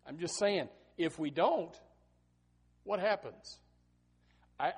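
ordinary talk

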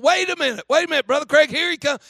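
high, loud mimicked hollering; hollering kids. Kids on the bus stop Brother Craig, hollering. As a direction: yell urgently like kids stopping the bus. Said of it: "the kids started hollering".

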